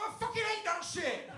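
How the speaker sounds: loud, high, exploding in disgust; outraged yell. Retold or imitated. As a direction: blurt it out furious. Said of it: "I was like".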